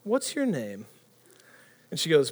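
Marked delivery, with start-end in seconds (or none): 0.0-0.9 soft, mock-suave come-on; hushed would-be charmer; smooth talker. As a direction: play the buddy who thinks he's smooth. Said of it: "thinks that he's smooth".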